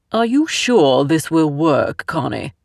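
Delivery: put-on doubtful man in a Southern accent; thick Southern accent. A narrator's reading. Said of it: "a man's voice asked in a thick Southern accent".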